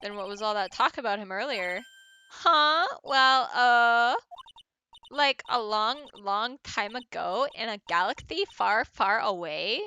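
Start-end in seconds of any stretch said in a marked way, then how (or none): 2.3-9.8 put-on lisping valley girl, caught out; Inny Mini Miny Mo. Witness covering up for herself, lisping airhead voice. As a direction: hedge in a ditzy girl's lilt. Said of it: "I gave her the same voice".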